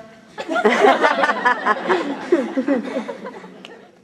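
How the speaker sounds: big happy cackle; loud laugh. Acted. Nurse laughs joyfully.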